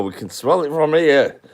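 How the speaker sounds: loud Bolton comic relish on garlic bread; Peter Kay impression. Streamer doing Peter Kay for the chat, loud and relishing. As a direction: savour it like a northern comedian. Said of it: "That's Peter Kay".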